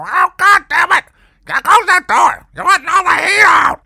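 screeching high, loud, slurred R's, furious and shrill-mouthed; angry landlady shout. Retold or imitated. Landlady yelling at the tenant for opening the oven, high loud angry. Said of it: "all angry-like, or as she liked to call it, puckered".

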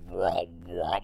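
low, soft mock wail; deep mock whine of a throbbing head; throbbing headache noise. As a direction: groan out the pain pulses. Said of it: "it's like".

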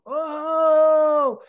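loud, high, sustained groaning "oh"; drawn-out wailing groan, re-enacted; long groan. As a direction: let one long groan pour out. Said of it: "a big groan came out of me".

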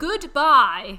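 over-the-top dismissive send-off; mock farewell. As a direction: belt out a joking goodbye. Mock farewell after "isn't worth it.

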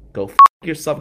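student telling the bully off; angry shout. Retold or imitated. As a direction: yell it in his face. Cursing out the bully.

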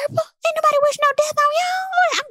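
high, sing-song dismissal; sassy high retort. Retold or imitated. Host answering Karen, pitched high and sassy. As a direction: brush her off from way up high.

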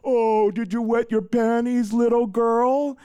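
high, sneering bully coach taunt; mocking taunt. Coach jeering at a small boy, mocking taunt. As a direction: belittle the small kid with a jeer. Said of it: "always making fun of some kid".

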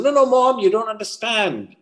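high-pitched mock teen protest; protesting teenage son. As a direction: plead like a thwarted teenager. Teen pleading with mother.

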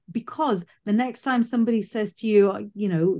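normal talking voice

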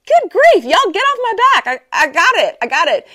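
high, loud, playful get off my back; mock exasperation. She tells the friends to get off her back, high mock exasperation. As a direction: throw up your hands in fake annoyance. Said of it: "I'm like".

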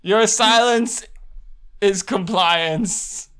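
very slow, heavy pronouncement; slow ominous. Acted. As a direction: draw each word out with menace.

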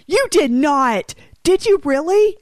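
can't believe what she's hearing; shocked disbelief. Real, not acted. Friend took her drink.

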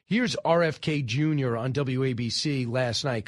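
ordinary delivery

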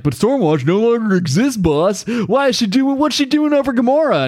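high-pitched, put-on dimwit voice; clueless idiot henchman. Idiot underling asks his boss about Stormwatch, clueless and high-pitched. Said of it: "this one idiot is like".

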